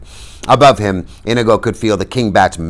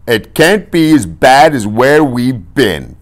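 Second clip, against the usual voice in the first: loud, high irritated retort; slow, exasperated high voice; Fezzik snapping; 6 semitones higher, 6 dB louder, slower than usual. A narrator's reading. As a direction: snap it back, loud and drawn out. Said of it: "Fezzik snapped".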